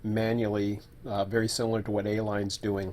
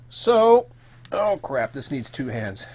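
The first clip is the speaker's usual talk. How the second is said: surprised; 3 semitones higher, 5 dB louder, slower than usual. Real, not acted. Needs two hands, surprised.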